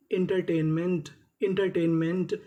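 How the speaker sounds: normal talking voice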